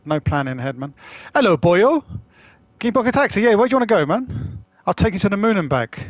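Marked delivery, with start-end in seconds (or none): 1.3-6.1 lightly hammed Welsh accent. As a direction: play a cheeky Welsh cabbie, pitched up. Greeting Welsh chatter with voice.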